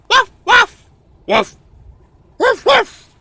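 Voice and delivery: high, loud imitated woofs; dog barking. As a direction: yap like an excited dog. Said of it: "There's a dog".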